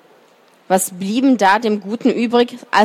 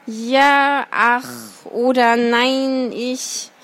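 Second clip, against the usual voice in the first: stammering fox; 4 semitones higher, much slower than usual. A narrator's reading. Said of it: "versuchte er, sich zu rechtfertigen".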